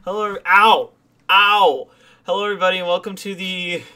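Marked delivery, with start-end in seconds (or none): 0.0-1.9 pained yelp. Real, not acted. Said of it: "I think I have a splinter in my foot".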